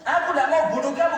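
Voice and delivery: loud, high, fast retort; heated refusal. Acted. Amr refusing to kill Ali, loud and fast. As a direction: fire it back loudly and quickly.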